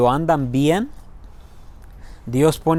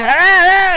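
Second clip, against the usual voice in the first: short high mock scream; mock yell; 13 semitones higher, 5 dB louder than usual. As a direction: neighbour yelling at his daughter, a high mock yell. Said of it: "le grita".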